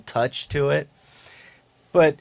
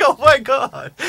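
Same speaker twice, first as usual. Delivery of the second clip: high, laughing disbelief; laughing oh my God; 12 semitones higher, 6 dB louder, slower than usual. Retold or imitated. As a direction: laugh it out in shock. Retold shocked laughing reaction.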